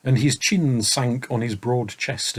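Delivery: plain reading voice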